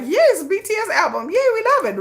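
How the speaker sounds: high, squealing fake enthusiasm; mock forced cheer. Critic forced to praise the album, shrill fake joy. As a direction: gush like you're terrified not to.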